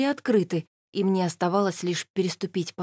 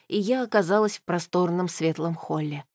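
usual speaking voice

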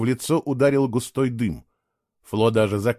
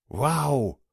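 surprised blurt; 2 semitones higher than usual. Acted. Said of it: "вырвалось у Фло".

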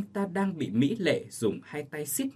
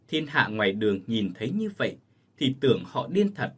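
ordinary delivery

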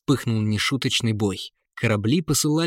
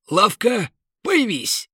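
magic command; 10 semitones higher, 4 dB louder, slower than usual. Acted. Merchant casts magic command.

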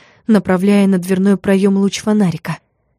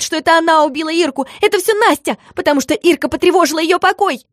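much higher, shrieking protest; shrill scandalous yell; 9 semitones higher than usual. A narrator's reading. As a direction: shout back in a hysterical fit. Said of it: "скандально выкрикнула Мотя".

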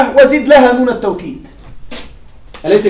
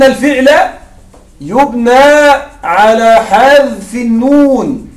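slow emphatic rule; 3 dB louder, much slower than usual. Shown as a demonstration. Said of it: "انتبه معي".

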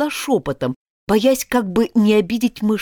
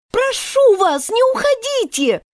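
higher pleading call after her; Alice calling after the mouse, pleading. Acted. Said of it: "крикнула ей вслед Алиса".

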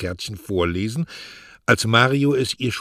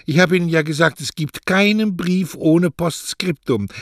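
much higher, louder, elated boasting; triumphant joy; 10 semitones higher, 6 dB louder than usual. Acted. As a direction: crow it out, overjoyed. Mario exults over the postscript.

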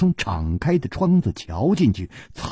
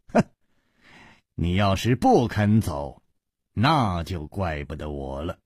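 cold and quiet, dropping softer to the end; a low, icy threat; 7 semitones lower, 4 dB quieter than usual. A narrator's reading. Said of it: "冷冷地说". (冷冷地、压低声音的威胁)

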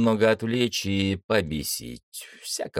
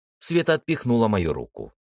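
usual speaking voice

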